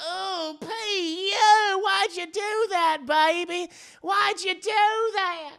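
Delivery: high, slow, drawn-out whine; mock dismay. Everyone reacts to the mess, whiny mock dismay. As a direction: stretch the words in hammed-up disgust.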